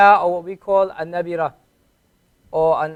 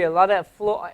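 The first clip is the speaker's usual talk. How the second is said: normal talking voice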